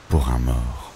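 ordinary delivery

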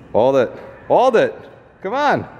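real gym-coach urging, pitch leaping up; urgent coaching. Real, not acted. Son coaching mom through the last rep, urgent urging. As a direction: push them to hold on.

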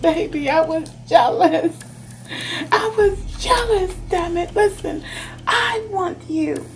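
high, impassioned lover's confession; jealous outburst. Acted. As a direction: cry out in acted jealousy and longing. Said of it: "This person's like".

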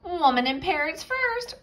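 high parrot voice; parrot squawk. Acted. Parrot demanding rescue, high squawk. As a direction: screech it like a bird.

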